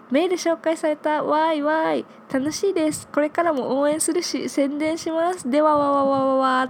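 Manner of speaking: bright-eyed fan email, pitched high; high-energy excitement. Acted. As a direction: read it out with high, bubbly energy. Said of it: "このテンション高いうーかさん".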